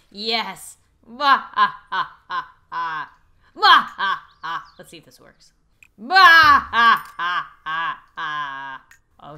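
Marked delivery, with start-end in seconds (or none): 1.1-4.7 loud hammed-up evil bah-ha-ha; villain laugh. Playing the pool villain. 4.8-5.5 whispered, unvoiced; whispered aside. Real, not acted. Aside between the cackles. 6.0-8.8 loud hammed-up evil bah-ha-ha; villain laugh. Playing the pool villain.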